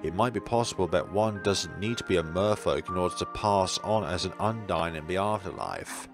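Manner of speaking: ordinary delivery, nothing marked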